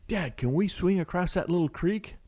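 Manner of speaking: a small daughter eagerly asking her dad; child's voice. Retold or imitated.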